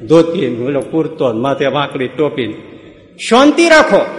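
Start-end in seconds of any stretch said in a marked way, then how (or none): 3.2-4.1 put-on high, hearty old uncle calming everyone; village uncle. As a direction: boom out like a confident village elder. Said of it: "Charotari કાકા બેઠા છે".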